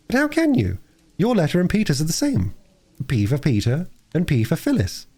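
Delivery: put-on bright eager little girl; a lightly voiced excited young girl. Phyllis voiced.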